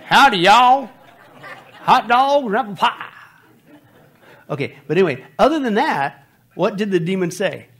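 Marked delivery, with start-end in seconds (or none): 0.0-3.1 loud, high, hammed-up American twang; mock American accent. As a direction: holler it like a cartoon American. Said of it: "do they sound American".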